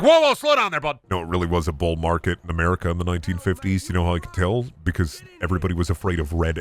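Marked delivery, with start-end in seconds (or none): 0.0-1.0 high mock protest. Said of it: "You'd be like".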